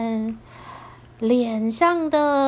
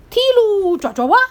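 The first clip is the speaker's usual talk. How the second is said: shouted spell; 5 semitones higher, 5 dB louder, faster than usual. Acted. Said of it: "然后说".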